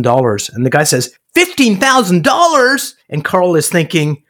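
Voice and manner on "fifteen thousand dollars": much higher, slow, astonished question; incredulous echo. Retold or imitated. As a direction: echo the price back in disbelief. Buyer echoes the price.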